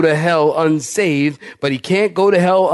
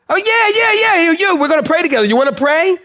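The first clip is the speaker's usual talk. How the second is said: eager insistence; 12 semitones higher, faster than usual. Retold or imitated. Insisting she pray, retold.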